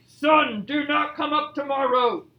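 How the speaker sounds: loud, emphatic bear voice; loud Bear command. Shown as a demonstration. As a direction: punch out SUN loudly, then order it firmly. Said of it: "as loud as I could".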